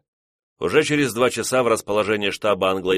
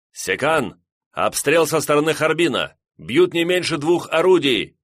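adjutant yelling a report, higher; shouting over battle; 7 semitones higher, 3 dB louder than usual. Acted. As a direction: call it out over the gunfire. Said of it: "прокричал адъютант сквозь грохот разрывов".

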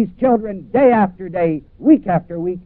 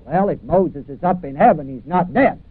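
ordinary delivery

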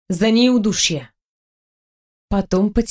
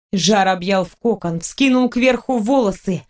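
plain reading voice

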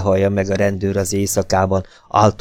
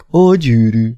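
woman's voice; 5 semitones higher, 5 dB louder than usual. A narrator's reading. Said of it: "Halk, tiszta hangokra ébredek".

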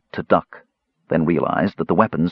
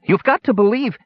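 high-pitched, pleading kender voice; faltering kender; 14 semitones higher than usual. A narrator's reading. Kender begging the warrior to believe, faltering high voice. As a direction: plead high, losing your hold. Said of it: "he faltered".

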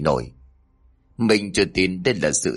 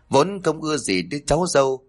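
ordinary delivery

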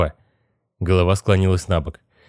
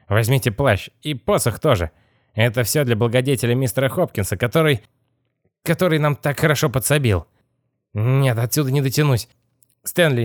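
higher-pitched character voice, politely giving orders; fussy old robber boss; 5 semitones higher than usual. Acted. Boss asking his helper to fetch loot, courteous higher voice. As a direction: play a busy gang leader, pitched up.

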